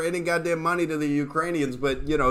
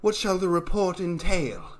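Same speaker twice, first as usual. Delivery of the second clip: wannabe James Bond. Retold or imitated. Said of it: "a wannabe James Bond".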